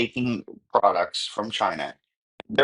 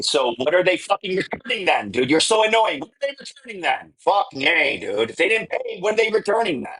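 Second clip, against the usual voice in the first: exasperated; 7 semitones higher than usual. Real, not acted. Exasperated outburst at opponent.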